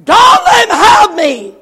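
high, drawn-out scream of terror; slow, full-force panicked scream; terrified cry. Retold or imitated. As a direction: cry out desperately for protection. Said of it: "The young man cried".

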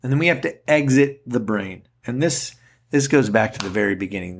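normal talking voice

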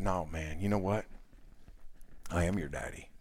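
low, gentle, steady reassurance to a hurt son; tender reassurance. Retold or imitated. Father on the bed comforting his upset son, tender and low. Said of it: "I sat there and I said".

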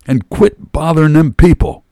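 high, loud scolding afterthought; bossy high-pitched shout; yelling. A narrator's reading. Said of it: "with hands on hips and yelled".

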